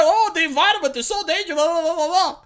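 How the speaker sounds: fast, lightly mocking whiny celebrity; a touch of a whining actor; whiny wimp. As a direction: rattle it off like a whiny star. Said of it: "these whiny wimps go around and talk".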